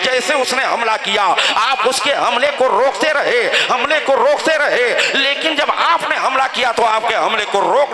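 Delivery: full-throated, high-pitched combat narration; rousing battle preaching. Acted. Preacher recounts the duel to the congregation, rousing battle preaching. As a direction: declaim the fight with driving force.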